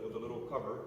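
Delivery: ordinary talk, nothing marked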